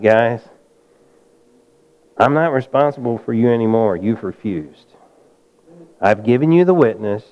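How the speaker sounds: low, soft, grave apostle's voice; Paul's farewell. Acted. Paul leaving the synagogue people who refused him, low and grave. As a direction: let him go quiet and final, washing his hands.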